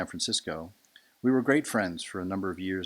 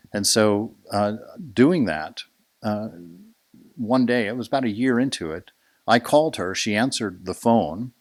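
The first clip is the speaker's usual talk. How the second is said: storytelling climax; 2 semitones higher, 9 dB louder than usual. Real, not acted.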